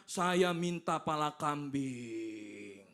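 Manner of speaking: slow, low, drawn-out jinn voice; jinn's demand. Acted. Jinn demands a goat's head from the shaman, slow low intoning. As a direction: intone it as a spirit, stretching the words. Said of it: "Apa dikatakan?".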